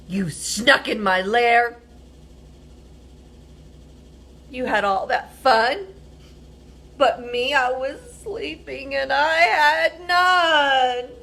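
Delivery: mock-angry bear grumble; grumpy bear. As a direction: scold them like a sulky woken bear. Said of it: "He growls and he grumbles".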